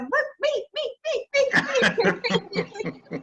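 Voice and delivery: put-on high, excited child's squeal for attention; little kid. Younger admirer playing a kid jumping for attention, high and squeaky. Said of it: "how you get that little kid".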